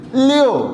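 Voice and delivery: لیو with the stress on the final u, the i kept short; لیو, u vowel prominent; u sound in leo. Shown as a demonstration. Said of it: "یو کی sound آ رہی ہے".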